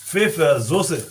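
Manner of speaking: Pfeffersoße said the German way, fe-fer-zo-se; carefully sounding out Pfeffersoße in German; German word. Shown as a demonstration. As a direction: show how Germans say Pfeffersoße. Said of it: "En allemand, c'est".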